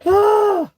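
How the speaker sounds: a sharp high piercing yelp, loud; sudden high yelp. Real, not acted. Yelping loud while chatting on the live stream. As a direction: let out a high loud cry of surprise.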